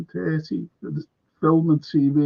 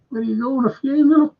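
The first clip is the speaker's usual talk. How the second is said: higher, teasing mock jeer; mocking jibe; 9 semitones higher, 4 dB louder, faster than usual. Lads teasing him about his suit, mock jeer.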